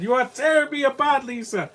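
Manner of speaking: high-pitched hammed-up film quote; Tommy Wiseau. Reactor quoting a film to mock an unclear villain, hammed-up impression. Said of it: "Even Tommy Wiseau, I can understand".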